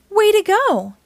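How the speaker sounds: high, loud cheer; excited praise. Acted. Cheering young listeners for finding the trail, excited. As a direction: celebrate the kids.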